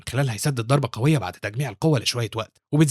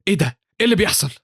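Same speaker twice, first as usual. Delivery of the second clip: higher-pitched indignant questions; angry outburst; 7 semitones higher, 4 dB louder, faster than usual. A narrator's reading. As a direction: demand an answer in annoyance. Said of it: "وبيتعصب وانج ساعتها وبيقول بغضب".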